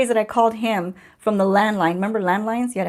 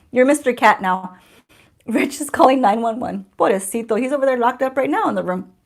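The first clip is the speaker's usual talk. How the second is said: ordinary talk, nothing marked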